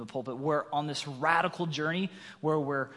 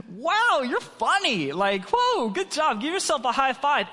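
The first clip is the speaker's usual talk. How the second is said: loud, high sarcastic mockery; hammed-up sneering praise; mocking; 9 semitones higher, 6 dB louder, slower than usual. Husband mocking his wife's church idea, loud sarcastic. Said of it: "I go on to mock her".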